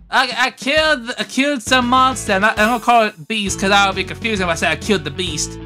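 ordinary delivery

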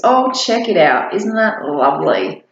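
plain reading voice

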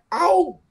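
sudden high "au" like an ouch; pinched "ow". Shown as a demonstration. Teacher likens the vowel to being pinched, high yelped "au". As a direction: yelp "au" as if pinched. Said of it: "ai nhéo mình một cái".

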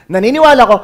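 loud, high mock proclamation; imitating a confident claim of belief; acted declaration. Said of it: "Paano niya nasabi yun".

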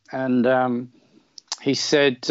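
ordinary talk, nothing marked

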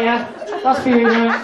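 normal talking voice

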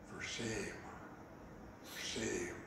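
low, soft grumble; grumbling disapproval. Acted. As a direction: grumble it under your breath in reproach. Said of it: "People began to grumble".

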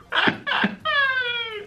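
a squeaky high burst of laughter; high giggle. Real, not acted. Gamer laughing at an opponent, high giggle. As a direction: laugh up high at the enemy's mistake.

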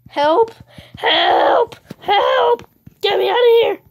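high, very loud game yell; mock-desperate call for help. Trapped player calling to get out, mock-desperate yell.

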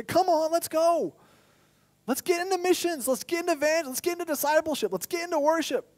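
eager rallying. Acted. The mind rallying the heart, eager and high. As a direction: pump up a lazy teammate. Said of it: "the mind talking to the heart".

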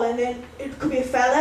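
ordinary talk

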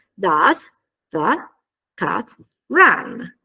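DOES, THE, CAT, RUN each separated by pauses; word-by-word. Shown as a demonstration. Teacher assembling the question's structure, word by word. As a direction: build the question slowly, piece by piece. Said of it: "aggiungiamo la frase di domanda".

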